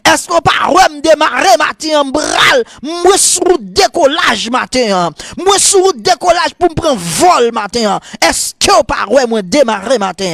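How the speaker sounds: high, fast-building revival sermon; full-force preaching. Real, not acted. Preacher warning the church about sin, full-force preaching. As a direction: drive each phrase high, then drop on the ending.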